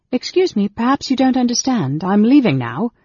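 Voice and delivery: high, firm, forceful courtesy; polite but stern. A narrator's reading. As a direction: stay polite, make it clear you mean it. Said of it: "said politely, but in a stern tone".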